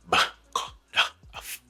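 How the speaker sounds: softly voiceless consonant sounds, one by one; consonant sounds demo. Shown as a demonstration. Teacher showing his students the consonant sounds, breathy voiceless demo. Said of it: "I detailed consonant sound".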